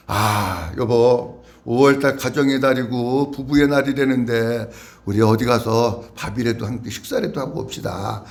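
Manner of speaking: low, soft example voice; spouse suggesting dinner. Pretend. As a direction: propose a meal out, quietly and low. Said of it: "예를 들어서 가족이나 부부가". (배우자에게 낮고 조용히 외식을 권하듯)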